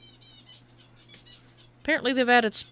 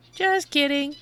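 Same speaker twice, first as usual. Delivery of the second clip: louder shady trader voice, sly; secretive raspy sales murmur; 6 semitones higher, 5 dB louder, slower than usual. Acted. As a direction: sell the joke like a shady dealer. Redone in trader voice.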